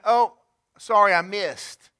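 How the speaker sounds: high, loud hammed-up oops; mock apology. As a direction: play a careless God fumbling. Mock God missing with grace.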